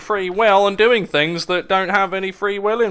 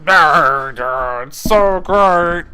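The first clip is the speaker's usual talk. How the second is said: sarcastic; 6 dB louder, much slower than usual. Real, not acted. Sarcastic after sigh.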